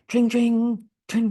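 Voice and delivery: high, sung-out "dring dring"; ringing trill on a held pitch; phone ringing imitation. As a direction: voice an old telephone ring. Imitates a ringing phone in role play.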